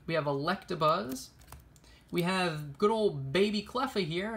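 usual speaking voice

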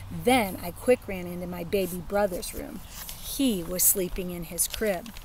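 plain reading voice

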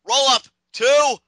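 high, loud near-fall call; excited call. Real, not acted. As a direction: yell the count as it happens.

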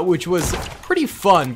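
ordinary talk, nothing marked

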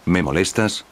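plain reading voice

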